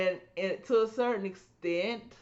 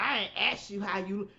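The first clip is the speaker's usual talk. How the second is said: rude woman; 5 semitones higher than usual. Retold or imitated. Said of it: "talking about".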